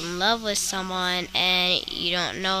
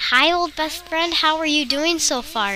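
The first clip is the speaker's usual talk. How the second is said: overeager greeting; 8 semitones higher, 7 dB louder than usual. Acted. Greeting old friend, acted.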